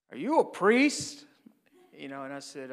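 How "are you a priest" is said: high, loud, drawn-out question; imitated stranger's question. Tattooed man confronting the priest, loud slow questioning. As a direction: slowly demand it, voice jumping up.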